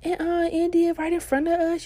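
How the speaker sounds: high, loud mock outcry; clamouring friends. Friends comment right behind the woman, high and loud.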